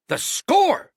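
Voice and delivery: very high, loud outburst; a sudden cry of remembering; burst out. A narrator's reading. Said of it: "he burst out".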